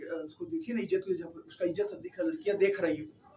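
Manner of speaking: low, soft confidential voice; discreet aside. Retold or imitated. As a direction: murmur a discreet warning to an uncle. Aviso discreto al tío, recreado.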